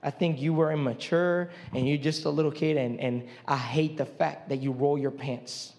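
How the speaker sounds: low, lightly mocking critic voice; a hint of a sneering critic; quoting a critic. Preacher quoting what people tell him, lightly mocking. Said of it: "I've had people come and say this".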